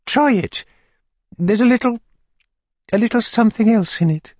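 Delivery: high, fluttery widow voice; fussy widow voice. A narrator's reading. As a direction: play the flustered widow, pitched high. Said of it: "Widow Corney's voice".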